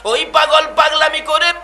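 high-pitched preaching to the crowd; impassioned preaching. Real, not acted. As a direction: preach it at full force, stretching the phrase endings. (উঁচু সুরে আবেগময় ওয়াজ)

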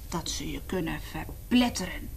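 whispered scary detail; spooky whisper. Acted. Reading to children, whispering about crushing beasts. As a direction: hush it ominously.

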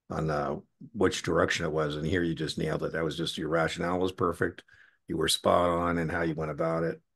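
ordinary talk, nothing marked

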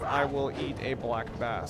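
ordinary talk

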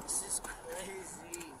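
slow under-breath whisper. Real, not acted. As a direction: breathe it out, barely voiced. Whispered aside during arrest.

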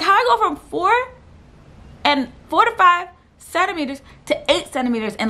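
high-pitched retold bewilderment; incredulous. Patient baffled by her quick progress, high incredulous voice. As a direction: question it in a high, astonished voice.

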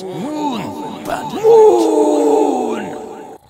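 high, drawn-out epic yell; dramatic shout. Acted. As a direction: bellow it like a trailer climax. Dramatic trailer climax.

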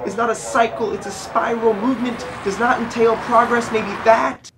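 loud, high, building poetic outcry; impassioned scream. Acted. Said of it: "that child in me will scream".

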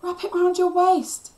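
urgent high shout. A narrator's reading. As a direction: shout an instruction across a distance. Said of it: "Cliff shouted".

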